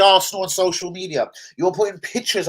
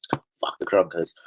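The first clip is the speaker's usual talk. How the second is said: slow bitter whisper; real whispered curse, slow; 13 semitones lower, 5 dB quieter, much slower than usual. Real, not acted. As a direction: hiss it under your breath at the owners. Cursing the owners under breath.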